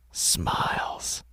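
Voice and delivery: ordinary delivery, nothing marked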